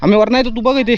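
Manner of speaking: loud, high call to a companion. Real, not acted. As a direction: call instructions across open ground. Directing companion across the rocks.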